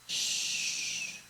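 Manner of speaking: soft unvoiced shh; hush sound. Shown as a demonstration. Preacher demonstrating the sound of silence, a soft shh. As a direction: hush the room with a long shh. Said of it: "Es este el sonido".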